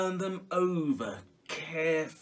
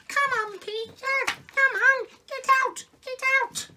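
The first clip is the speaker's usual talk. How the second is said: very high, louder bird voices; excited sparrows; 8 dB louder than usual. Acted. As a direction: squeak urgently like little birds. Sparrows urge Peter in excitement.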